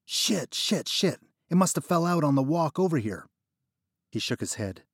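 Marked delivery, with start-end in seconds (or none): 0.1-3.3 higher, louder fake panic; playing the broke guy who lost his cash; acting out a lost-money panic. Narrator faking panic to a dealer. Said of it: "as I acted the part and kept saying".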